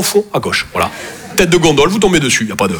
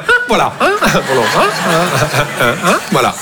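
1 mock mumble. Mocking the sales clerk.

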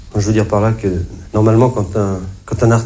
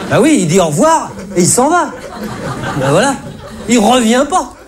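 comic indignation; 11 semitones higher than usual. Acted. Comic rant about a plumber returning.

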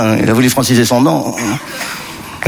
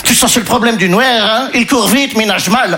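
higher, lightly put-on Belgian accent; 6 semitones higher than usual. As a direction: shrug it off in a high Belgian accent. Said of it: "là, je prends l'accent belge".

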